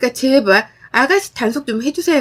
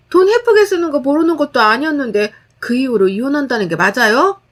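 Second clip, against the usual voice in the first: ordinary delivery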